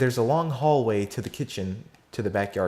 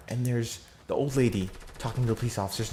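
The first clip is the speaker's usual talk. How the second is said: ordinary talk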